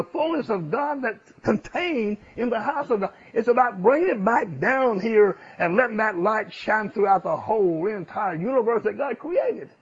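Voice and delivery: loud, rising preacher's cadence; full-force sermon voice; fervent preaching. Acted. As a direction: preach it out to the congregation.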